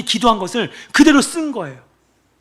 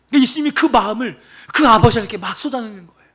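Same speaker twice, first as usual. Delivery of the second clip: ordinary delivery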